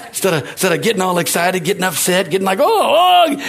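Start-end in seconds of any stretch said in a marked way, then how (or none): 2.6-3.4 high, loud exasperated groan; mock frustration. Believer getting upset instead of stepping back, mock frustrated outburst. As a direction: act out getting all worked up. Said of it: "getting all mad and frustrated".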